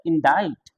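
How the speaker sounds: इंडाइट ending in "ait", no k; silent-C pronunciation. Shown as a demonstration. Teacher gives the correct word, इंडाइट with silent c. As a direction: rhyme the end of इंडाइट with kite. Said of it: "सी silent होता है".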